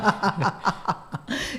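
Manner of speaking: real, open burst of laughter; hearty guffaw. Real, not acted. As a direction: let out a good long cackling laugh. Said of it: "tem que dar uma boa gargalhada". (gargalhada gostosa)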